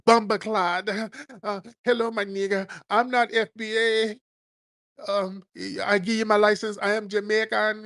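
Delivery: high-pitched hammed-up Jamaican accent; mock Jamaican accent. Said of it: "start talking with that accent".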